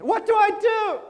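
very high desperate cry; hammed-up high wail. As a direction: cry it out as if just given bad news. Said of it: "to cry out".

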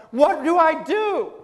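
high, loud, drawn-out cry; hammed-up desperate cry. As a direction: wail it as if your world collapsed, acted. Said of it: "to cry out".

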